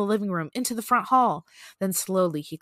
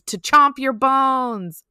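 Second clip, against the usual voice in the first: loud, slow, higher menacing answer; hammed-up creature threat; 5 semitones higher, 7 dB louder, much slower than usual. A narrator's reading. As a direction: stretch the last word like a story monster. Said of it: "the creature answers".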